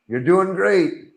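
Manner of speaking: high, drawn-out self-praise; hammed-up encouragement to your own reflection; mock pep talk. Reluctant man praising himself in mirror, mock cheer.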